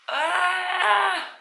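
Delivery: high, drawn-out "ah" cry; shocked scream. Real, not acted. Reader shocked at how few pages remain, high wail.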